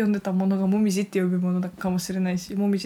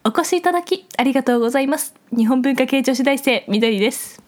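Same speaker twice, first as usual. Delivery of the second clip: put-on raised, loud, elegant young-lady voice; haughty rich girl japanese; 4 semitones higher, 6 dB louder than usual. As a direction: greet guests like a refined heiress. Ojousama podcast greeting.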